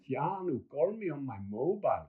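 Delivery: lightly put-on Swiss accent; a hint of a Swiss accent; playful Swiss-ish catchphrase delivery; mock Swiss accent. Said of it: "Swiss accent".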